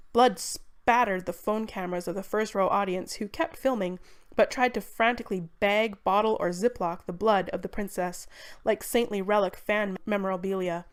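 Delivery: ordinary delivery, nothing marked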